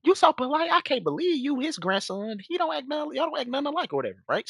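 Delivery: high-pitched, chatty female impression; quick, high mock-polite chatter; imitated polite woman. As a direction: mimic her gushing politely in a high voice. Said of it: "and she be like".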